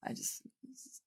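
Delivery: words stall as she fights back tears; choked up. Real, not acted. Host moved by the book passage, choked up. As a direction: voice catching into a breathless near-whisper. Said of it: "Oh, I'm getting emotional".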